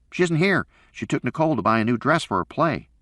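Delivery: put-on high boy's voice, earnest; a kid telling dad the news; young son. A narrator's reading. Son telling his father about his mother, earnest.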